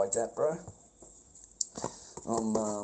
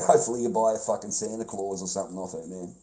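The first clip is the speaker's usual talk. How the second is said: ordinary delivery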